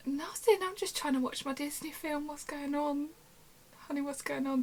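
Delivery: hammed-up innocent reply; mock innocent. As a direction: play dumb in a high, sugary voice. Feigning innocence to the accuser.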